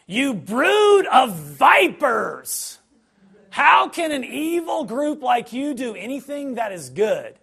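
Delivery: loud, high, slow denunciation; fierce rebuke. Retold or imitated. Jesus rebuking the Pharisees, slow and loud. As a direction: thunder it at the Pharisees.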